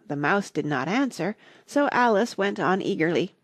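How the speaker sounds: ordinary delivery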